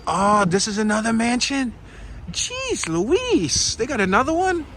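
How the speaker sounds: high, astonished exclamation; surprised. Real, not acted. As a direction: gasp it out, pitch way up. Surprised at another mansion.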